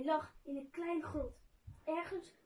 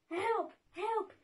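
higher-pitched cry for help, mildly acted; calling for help; 6 semitones higher, 4 dB louder than usual. A narrator's reading. Trapped Goos calling out for help, mildly acted. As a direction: read it as a restrained call for help. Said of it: "riep Goos".

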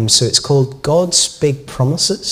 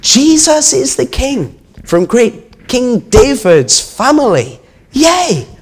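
high, slow, gleeful cheer; children shouting; 13 semitones higher, 5 dB louder, slower than usual. A narrator's reading. Children in the temple hailing Jesus, shouting. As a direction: chant it out like excited children. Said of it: "they heard some children shouting".

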